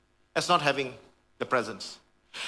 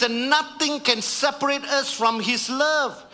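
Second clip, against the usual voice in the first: rising preacher's build, higher and stronger; preaching; 5 semitones higher than usual. Acted. Preacher rallying the congregation, building higher. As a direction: lift the congregation toward the promise.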